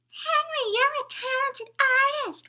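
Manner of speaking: bully mimics the driver in a shrill whine; mocking whine. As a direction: mimic him in a nasty high whine. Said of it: "whined Simon Snoot in a mocking voice".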